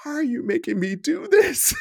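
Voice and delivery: high, sincere pleading client; earnest plea. Retold or imitated. Exhausted client earnestly asking trainer why, high. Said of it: "earnest is sincerity".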